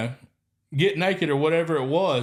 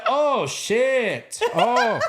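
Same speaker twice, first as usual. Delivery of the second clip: a high, laughing Ghanaian wail; a hammed-up Ghanaian oh shit; mock dismay; 9 semitones higher, 3 dB louder, much slower than usual. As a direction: Ghanaian coworker's catchphrase, in a mock dismayed Ghanaian accent. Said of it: "he used to say".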